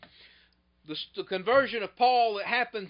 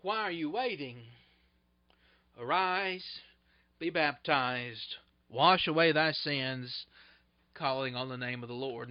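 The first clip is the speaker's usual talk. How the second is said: Ananias pleading softly; 7 semitones lower, 4 dB quieter than usual. Retold or imitated. Ananias urging Saul softly to arise and be baptized. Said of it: "He says".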